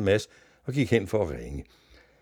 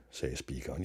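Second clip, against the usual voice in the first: ordinary delivery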